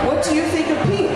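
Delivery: put-on light French accent for Marianne; a touch of French in the vowels; lightly French-accented girl asking. A narrator's reading. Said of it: "in her delightful French accent".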